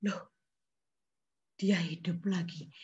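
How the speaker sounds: whispered, hushed wonder; awed whisper. A narrator's reading. Telling children the boy lives again, whispered. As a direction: breathe it in awe. Boy comes back to life.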